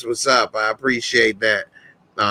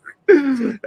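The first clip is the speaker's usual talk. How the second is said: laugh; 13 semitones higher than usual. Real, not acted. Laughing at his own joke.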